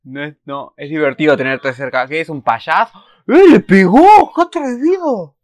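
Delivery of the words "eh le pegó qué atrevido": high, loud, slow shocked shout; how dare he, shouted high; shocked outburst. Real, not acted. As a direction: yell in shocked delight. Reacts to hit in game.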